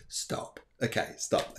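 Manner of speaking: unvoiced hushed stop, to herself; whispered stop. Real, not acted. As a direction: whisper it under your breath.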